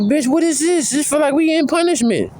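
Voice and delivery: high, baffled, mock outrage; incredulous retort. To the girl who wants silence, high incredulous mock protest. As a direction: pitch shoots way up in disbelief. Annoyed reply to quiet girlfriend.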